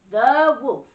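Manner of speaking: loud, high, drawn-out announcement; storyteller unveiling the scariest baddie; dramatic reveal. A narrator's reading. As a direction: land the reveal with a big spooky swell.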